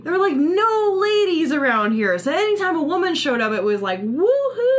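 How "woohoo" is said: high whooping cheer; excited cheer. Retold or imitated. Men cheering at a woman.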